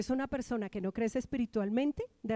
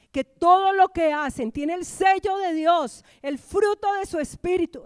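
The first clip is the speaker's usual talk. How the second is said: loud, high, ringing proclamation; forceful preaching; 6 semitones higher, 9 dB louder than usual. Real, not acted. Preacher declaring the seal of God on all they do, forceful preaching. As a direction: drive it home like a preacher.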